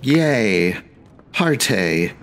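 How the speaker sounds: ordinary talk, nothing marked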